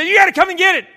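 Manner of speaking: high, loud mock wife holler; urgent demand. Wife demanding husband deal with rat, urgent holler. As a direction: imitate a wife yelling for help.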